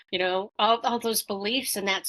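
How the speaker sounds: usual speaking voice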